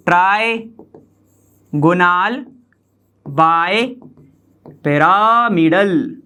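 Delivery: Trigonal bipyramidal, very slow, part by part; slow dictation. Shown as a demonstration. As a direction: stretch out trigonal bipyramidal with long breaks between the parts. Teacher repeats term piece by piece. (Trigonal bipyramidal को खींचकर, टुकड़ों में बोलें)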